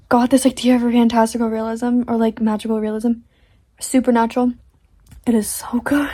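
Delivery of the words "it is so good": whispering. Real, not acted. Whispered excitement over book idea.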